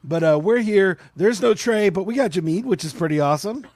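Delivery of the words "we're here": normal talking voice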